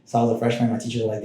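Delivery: ordinary talk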